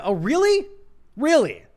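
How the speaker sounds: loud, sarcastic "really?" rising high; sarcastic disbelief. Real, not acted. Scoffing at conservatives who say it can't happen, loud sarcastic. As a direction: throw it back at them with mock disbelief.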